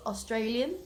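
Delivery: mock American "Australian?" with a questioning rise; imitating American. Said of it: "you went".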